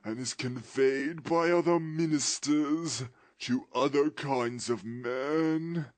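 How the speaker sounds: slow, high, loud mournful wail; ghost's voice. Acted. Ghost lamenting its fate to Scrooge in a slow wail. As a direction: lament your doom from the grave.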